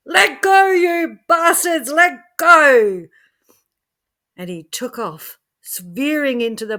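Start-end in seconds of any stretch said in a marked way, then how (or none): 0.1-3.1 very high, loud furious scream. Acted. Joe fighting off the aliens, screaming furiously. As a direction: yell at them to let go. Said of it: "Joe screamed for all he was worth".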